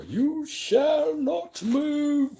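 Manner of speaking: high, slow booming wizard; Gandalf. Retold or imitated. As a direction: proclaim slowly like a wizard. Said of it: "like Gandalf the Grey standing at the door".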